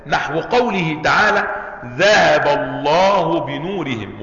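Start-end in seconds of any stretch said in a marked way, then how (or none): 1.8-4.2 reverent, drawn-out verse reading; slow Quranic recitation. Shown as a demonstration. Teacher reciting the verse to his students, slow and drawn out. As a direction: stretch each word out like recitation.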